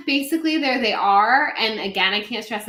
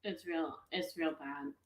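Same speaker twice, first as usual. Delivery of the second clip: much softer, half-distracted murmur; 7 semitones lower, 19 dB quieter than usual. Real, not acted. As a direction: mumble while typing a text. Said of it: "hold on, I'm almost done with".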